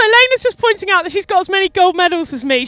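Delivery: ordinary delivery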